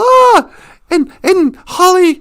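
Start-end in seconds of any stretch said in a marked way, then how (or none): 0.0-0.5 a sharp hammed-up scream, high and loud; mock scream. The family yells as they go over the falls. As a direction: yelp in mock terror. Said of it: "and they go".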